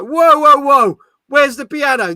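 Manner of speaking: loud, high "whoa, whoa, whoa"; hammed-up indignant outburst; mock outrage. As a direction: protest loudly as if scandalised. Acts out mock outrage at news.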